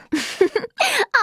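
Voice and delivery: short high-pitched giggle; pleased little laugh; chuckle. Acted. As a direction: giggle lightly at a trick pulled off. Pleased after the ruse works.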